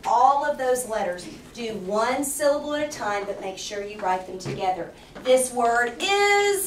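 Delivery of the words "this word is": slow prompt. Shown as a demonstration. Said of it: "teacher prompting, slow".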